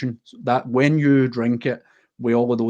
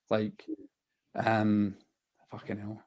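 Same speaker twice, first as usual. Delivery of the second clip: choked up; 3 semitones lower, 9 dB quieter, slower than usual. Real, not acted. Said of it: "I'm getting a bit emotional talking about it".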